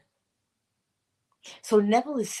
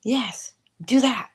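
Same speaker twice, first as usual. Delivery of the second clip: slow whisper; 3 semitones higher, slower than usual. Real, not acted. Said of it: "unvoiced whisper".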